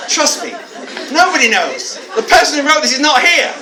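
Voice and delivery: a teacher laughing as he admits nobody knows. Real, not acted. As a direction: laugh it out while telling them.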